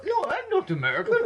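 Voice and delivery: put-on high, loud, laughing Russian accent. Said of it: "He won't do Russian like this".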